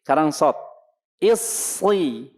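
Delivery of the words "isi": Isi with a thin light s, voiced high; model Isi. Shown as a demonstration. Teacher models thin Isi for the class.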